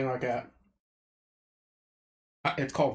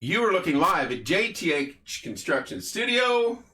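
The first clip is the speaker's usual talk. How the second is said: slow, booming broadcast announcer, higher and louder; sportscaster intro; 5 semitones higher, 5 dB louder, slower than usual. Pretend. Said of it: "I gotta do my new intro".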